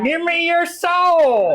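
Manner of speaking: very high, slow cartoon villain shout; Skeletor voice. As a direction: shriek it like Skeletor with a twang. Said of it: "that was Skeletor with a weird southern accent".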